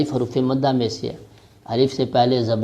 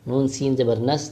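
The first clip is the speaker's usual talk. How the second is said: ordinary talk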